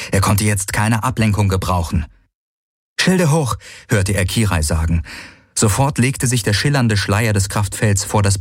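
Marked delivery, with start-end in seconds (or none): 3.0-3.6 high-pitched battle order; sharp, raised-pitch urgency; urgent command. A narrator's reading. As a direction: call out for shields under attack. Urgent order during space battle.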